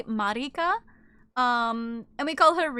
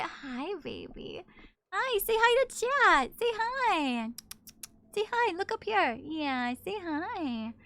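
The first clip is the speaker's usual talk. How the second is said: cooing at the cat; 6 semitones higher, slower than usual. Real, not acted. Talking to the cat on stream.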